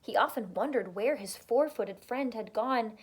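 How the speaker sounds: ordinary delivery, nothing marked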